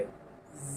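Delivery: জ with teeth together, hissy; buzzing friction. Shown as a demonstration. Teacher models the rubbing sound for students, hushed. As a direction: let জ buzz like a cricket. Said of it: "জিজি পোকার আওয়াজের মতো".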